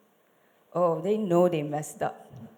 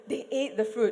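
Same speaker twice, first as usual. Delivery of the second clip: laughing retake; 3 semitones higher than usual. Shown as a demonstration. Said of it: "Let's do it again".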